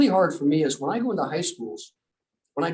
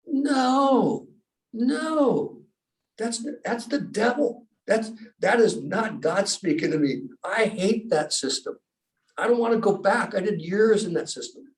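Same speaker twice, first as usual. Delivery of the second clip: alarmed refusal; 6 semitones higher than usual. Retold or imitated. Rejecting the voice in his head.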